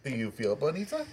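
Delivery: sing-song cartoon impression of Chris; hammed-up cartoon sales pitch; mock cartoon voice. Imitating Family Guy Chris bit.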